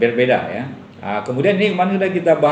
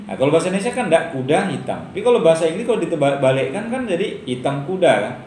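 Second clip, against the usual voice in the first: normal talking voice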